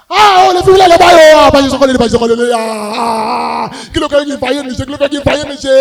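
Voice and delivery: loud, high mock lament; hammed-up wailing. Comedian mocking poor mourners crying over lost drink. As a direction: howl over the spilled drink.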